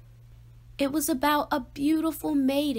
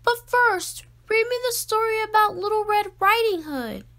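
eager pleading; 9 semitones higher, 5 dB louder than usual. A narrator's reading. Pleading child asks for story.

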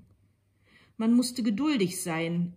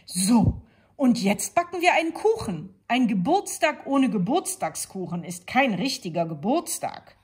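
cheerful wolf; 5 semitones higher, 7 dB louder than usual. A narrator's reading. Wolf takes charge.

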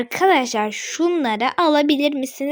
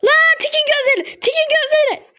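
much higher, loud alarmed cry; alarmed shout; 12 semitones higher, 4 dB louder than usual. Real, not acted. Gamer alarmed by the enemy Tik, shouting. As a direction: yell a warning in real alarm.

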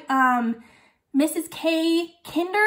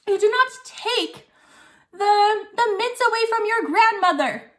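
"not" hit high and firm; scold, punching the word "not"; stress on NOT; 7 semitones higher than usual. Acted. Scolding about being polite.

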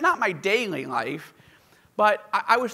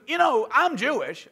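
lightly voiced dude host; 7 semitones higher, 5 dB louder than usual. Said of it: "he goes".

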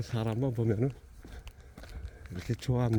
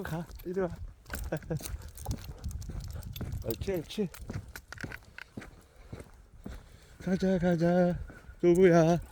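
talking to a dog; 6 semitones higher, slower than usual. Real, not acted. Coaxing the dog to come.

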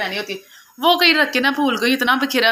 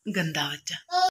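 soft chiding; 5 semitones lower, 9 dB quieter, much slower than usual. Real, not acted. Softly chiding the child.

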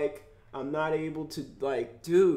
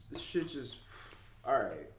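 normal talking voice

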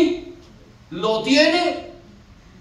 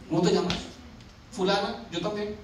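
casual introduction; 5 semitones lower, 9 dB quieter, faster than usual. Acted. Acts casual first introduction.

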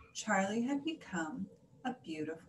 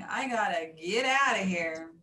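punched, slightly loud brush-off; dismissive send-off; 5 dB louder than usual. A narrator's reading. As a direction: brush them off with relish. Charlie snubs the monkeys.

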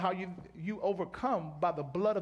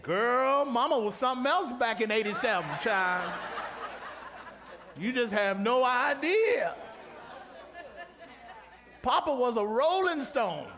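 hammed-up mother testimony, laughing, higher and louder; sassy mama; 5 semitones higher, 4 dB louder, slower than usual. Preacher as a mother telling her kids about eighty-seven, sassy. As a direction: play a mama spilling her past.

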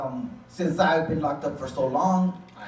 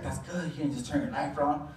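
softer, supportive lady's voice; soft, encouraging girlfriend; gentle encouragement; 5 semitones lower, 8 dB quieter than usual. Retold or imitated. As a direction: reassure him quietly. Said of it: "And she's like".

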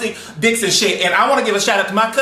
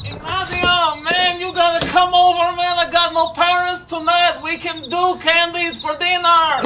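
lightly voiced little boy, pitched higher; little kid voice; 9 semitones higher than usual. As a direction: play an excited small kid. Kid inviting friend over.

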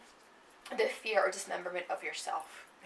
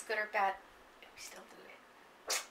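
ordinary delivery

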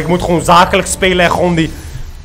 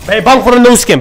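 louder, higher taunting question; mock the scared opponent loudly; loud gamer taunt; 5 semitones higher, 9 dB louder than usual. Real, not acted.

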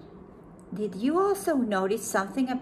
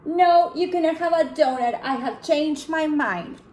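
higher, louder, slowing firm refusal; panda refusing; 6 semitones higher, 5 dB louder than usual. Acted. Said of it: "said the panda bear".

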